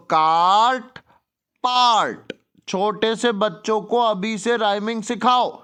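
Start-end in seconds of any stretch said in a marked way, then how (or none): 0.1-2.3 काट and पार्ट drawn out on a long "aa"; rhyme demonstration. Shown as a demonstration. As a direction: stretch the shared vowel in काट, पार्ट. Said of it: "ये rhyme होता है। बोलो".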